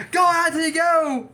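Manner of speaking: high, loud cheer; cheering shout. Retold or imitated. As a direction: yell encouragement at the rider. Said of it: "And she's".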